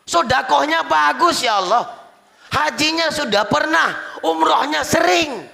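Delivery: high, insistent testimony; acted complaint. Wife lists husband's good deeds to God. (mengadu dengan nada tinggi)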